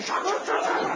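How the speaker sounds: sharp high clapping sounds; imitated clapping. Preacher mimicking the crowd clapping for him. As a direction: clap it out with the voice.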